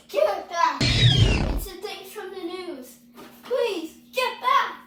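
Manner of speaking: high, loud cries of alarm; panicked shouting. Acted. Worker attacked by the thing from the news, panicked shouting. Acted attack by escaped raptor.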